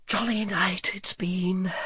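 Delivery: drowsy whispered murmur, slow; sleepy, contented whisper. A narrator's reading. Sleepy dragon murmurs to the boy, whispered and slow. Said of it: "he murmured".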